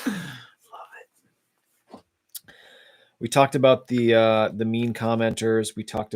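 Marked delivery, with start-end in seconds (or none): 0.1-2.1 whisper. Real, not acted. Aside whisper.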